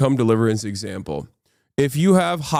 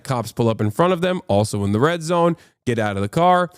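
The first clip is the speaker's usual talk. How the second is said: ordinary talk, nothing marked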